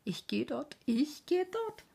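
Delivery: hoch und langsam, vor Lachen überschlagend; lachend gerufen. A narrator's reading. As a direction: ruf es, während du dich vor Lachen biegst. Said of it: "bog sich vor Lachen ... und rief". (Fieken ruft lachend über den Wall)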